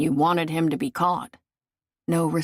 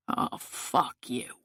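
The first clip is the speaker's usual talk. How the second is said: slow, hushed, whispered scorn; contemptuous hush; 4 semitones lower, 6 dB quieter, slower than usual. A narrator's reading. Killer's contemptuous retort.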